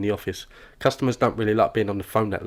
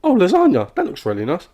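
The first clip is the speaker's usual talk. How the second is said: high, loud delighted exclamation; excited butting-in; 9 semitones higher, 6 dB louder than usual. Acted. Chatty colleague spots a coworker's lasagna, excited. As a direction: pounce brightly on the food.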